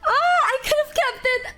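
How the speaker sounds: real teary high wail, half-crying; near tears. Real, not acted. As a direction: let the regret break into a cry. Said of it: "I was crying about it".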